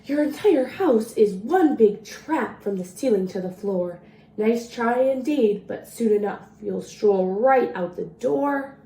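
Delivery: lively storybook read-aloud in the leprechaun's rhymes; reading to children. A narrator's reading. Librarian reading a leprechaun rhyme to children, playful read-aloud. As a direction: read it like a bedtime story for kids. Reads picture book to children.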